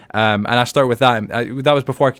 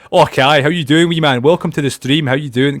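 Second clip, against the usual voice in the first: loud, higher, hearty Scottish greeting; broad Scottish; 4 semitones higher, 6 dB louder than usual. Shown as a demonstration. Streamer showing his old greeting, broad Scottish. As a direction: boom it out in broad Scots. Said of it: "a little bit too broad".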